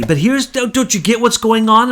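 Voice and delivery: hammed-up urgent pitch to a counterpart; exasperated appeal. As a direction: act out pressing him to understand. Acted deal-making argument with Putin.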